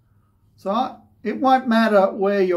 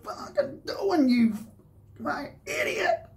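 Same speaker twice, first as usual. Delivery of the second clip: high, strained, tearful scream of rage; screaming at him; 9 semitones higher, 5 dB quieter than usual. Retold or imitated. At the bloke who broke his tooth, crying furious scream. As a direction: scream it through tears, voice cracking high. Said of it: "And I just scream at him".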